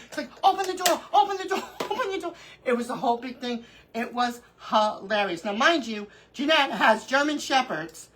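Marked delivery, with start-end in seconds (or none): 0.4-2.3 high mock-panicked pleading, repeated; retold panicked plea. Mother-in-law begging to escape the dog, mock panic. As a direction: imitate someone desperate to get out, amused.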